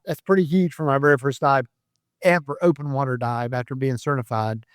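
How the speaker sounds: usual speaking voice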